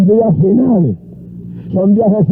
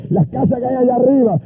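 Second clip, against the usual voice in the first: higher-pitched, urgent retold speech; excited plea; 7 semitones higher than usual. Convert urges the preacher toward the hillside houses, excited.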